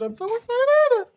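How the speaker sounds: squealing excitement. Real, not acted. Squealing with joy while opening the friend's package. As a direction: squeal it like you can't contain yourself.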